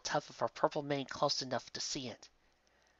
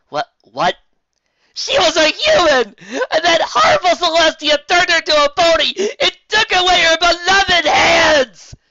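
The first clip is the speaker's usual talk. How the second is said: furious rant; 19 dB louder than usual. Acted. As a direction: scream your indignation. Lyra rages about the story.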